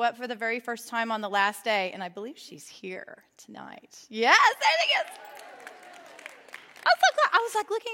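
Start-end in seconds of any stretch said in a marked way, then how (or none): 4.1-7.9 high, loud delighted exclaim; thrilled squeal of recognition; excited. Real, not acted. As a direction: burst out in joy at seeing her. Spots newcomer in crowd.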